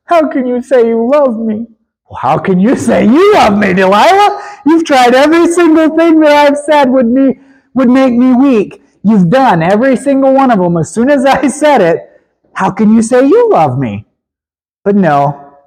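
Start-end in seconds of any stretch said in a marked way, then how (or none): 0.0-1.7 a light whiny Delilah plea; a hint of a whining lover; mock crying Delilah. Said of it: "she comes crying again". 2.1-14.1 exasperated retort. Acted. Samson retorts to Delilah.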